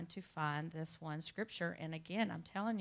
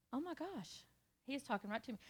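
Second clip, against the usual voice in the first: awed hush; 5 semitones higher, 14 dB quieter than usual. Retold or imitated. Her awed inner thought.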